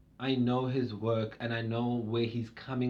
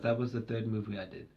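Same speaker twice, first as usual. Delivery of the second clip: usual speaking voice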